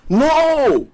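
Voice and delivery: high, sharp denial; emphatic no. Acted. Preacher firmly rejecting the misquote, high and emphatic. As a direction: shut the idea down hard.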